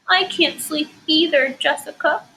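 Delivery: small, sad, scared voice. Shown as a demonstration. Teacher voicing lonely Ruthie at nap time, sad and scared. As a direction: sound lonely on her first day at school. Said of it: "a little sad and scared".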